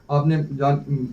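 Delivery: normal talking voice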